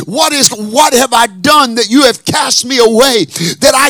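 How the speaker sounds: loud, rising preacher's cry over music; full-force preaching. Acted.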